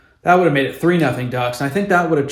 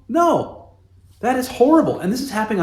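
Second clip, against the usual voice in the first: disgusted verdict; 10 semitones higher than usual. Real, not acted. Fan condemning team's play.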